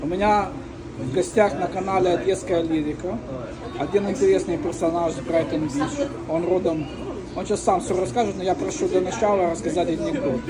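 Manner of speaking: ordinary delivery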